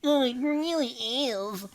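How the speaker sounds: slow, high nerdy voice; nerd voice. Acted. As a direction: play a slow nerdy know-it-all. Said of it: "What's a really smart guy voice?".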